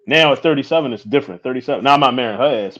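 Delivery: ordinary delivery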